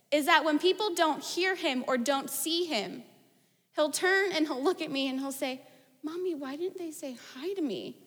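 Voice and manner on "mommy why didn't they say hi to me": put-on soft, small wounded child's voice; hurt little boy. Three-year-old asking mom why he was ignored, softly hurt. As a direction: ask quietly, like a crestfallen toddler. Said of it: "it's gut-wrenching".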